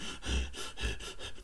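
heavy, audible puffing breaths; hard breathing. Shown as a demonstration. Host showing his trouble breathing, hard breathing. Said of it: "hear me breathing, like, really hard".

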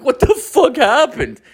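high, half-laughing disbelief; retold astonishment. Reacting to coworker's chase story.

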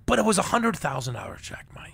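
real whisper, hushed in awe of the gift; awed whisper. Real, not acted. Telling the host about the hundred-thousand-dollar check, in an awed whisper. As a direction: whisper it like you still can't believe the sum. Revealing the surprise gift amount.

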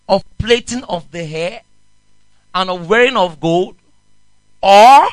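loud, ringing Bible reading; scripture reading. Acted. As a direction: proclaim the verse to the congregation. Preacher reads scripture aloud.

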